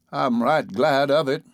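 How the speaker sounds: put-on slow, whiny old man voice; whining old man. A narrator's reading. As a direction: whine it like a cranky old man. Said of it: "whined the old man".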